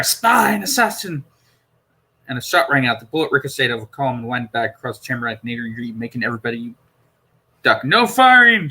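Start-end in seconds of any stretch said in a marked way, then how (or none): 0.0-1.2 slow, higher accusing cry. Acted. Guards crying out at the Doctor, accusing. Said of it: "Perry heard two voices cry". 7.8-8.7 loud, higher barked order; commanding. Acted. Robed man ordering the guards, commanding shout. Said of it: "in a commanding voice".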